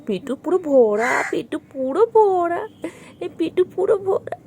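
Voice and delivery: slow, loud sing-song tease. Real, not acted. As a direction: drag it out playfully. Teasing full-bellied pet. (টেনে টেনে আদুরে সুরে)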